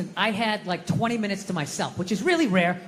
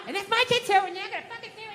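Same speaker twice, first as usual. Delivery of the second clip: wife's fading rant; 10 semitones higher, faster than usual. Retold or imitated. Wife ranting as husband zones out, imitated tirade fading away. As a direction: keep the high nagging voice going while fading into the distance.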